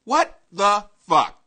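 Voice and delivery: ordinary talk, nothing marked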